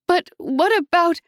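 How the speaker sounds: high, loud and urgent; desperate cut-off. A narrator's reading. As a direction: blurt it before being cut off.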